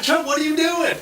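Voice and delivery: like catching a pal doing something alarming; alarmed question. Retold or imitated. Teller calls out to Chuck in startled surprise. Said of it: "So I said".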